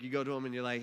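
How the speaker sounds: ordinary talk, nothing marked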